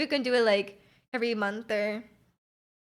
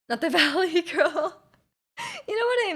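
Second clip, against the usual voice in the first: laughing; 6 semitones higher than usual. Shown as a demonstration. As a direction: giggle through the words. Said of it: "Valley Girl vocal fry going on".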